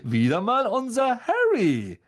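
swooping up high on the second phrase; grand, patronising heartiness; patronising boom. A narrator's reading. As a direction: boom it with condescending joviality. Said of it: "dröhnte gönnerhaft".

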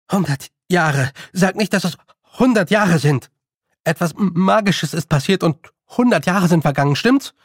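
rising agitation ending on a high question; alarmed. Acted. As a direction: press for the truth in alarm. Boy alarmed at time lost.

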